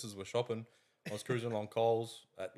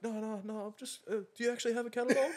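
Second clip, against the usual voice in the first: serious woman caller; 11 semitones higher than usual. Retold or imitated. Caller insisting she really wants a dog, imitated serious woman. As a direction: mimic her earnest protest in a much higher pitch. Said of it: "she was full serious".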